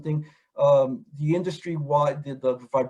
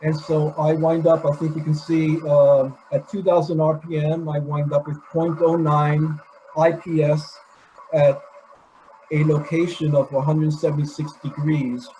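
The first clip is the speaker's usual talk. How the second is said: raised voice over a running engine; shouting over engine; 4 dB louder than usual. Real, not acted. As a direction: talk loud above the engine noise. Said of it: "I hope you can hear me over the engine".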